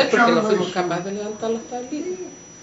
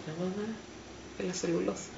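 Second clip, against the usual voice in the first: quiet retold reply; 2 semitones lower, 11 dB quieter than usual. Child explaining to mother that it's the pulp mill, quietly retold. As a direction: answer softly, as if correcting mum.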